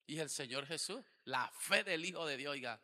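ordinary talk, nothing marked

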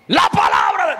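sudden loud joking roar; mock preacher bellow. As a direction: burst into a hammed-up preaching shout. Mock preaching bellow, then retracts it. (grito de predicador en broma)

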